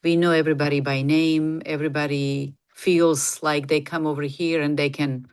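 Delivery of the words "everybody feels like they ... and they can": ordinary delivery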